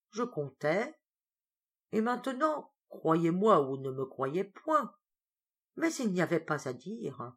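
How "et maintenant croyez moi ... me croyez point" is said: ordinary delivery, nothing marked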